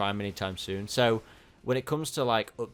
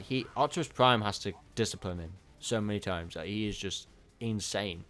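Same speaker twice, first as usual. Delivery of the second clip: ordinary talk, nothing marked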